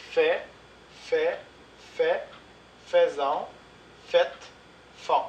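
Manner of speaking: fait said fɛ with the final T silent, faites said fɛt with only its T heard, font said with nothing after the nasal vowel; a careful model pronunciation of fait, faites and font for the class, each word alone; silent final letters. Shown as a demonstration. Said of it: "on ne prononce pas le S et le T".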